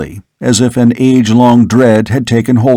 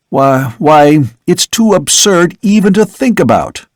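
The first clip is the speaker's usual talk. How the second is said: higher, urgent denial; vehement protest; 5 semitones higher than usual. Acted. Son jumping up to deny his father is a vampire, higher and urgent. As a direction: push back hard, pitch up.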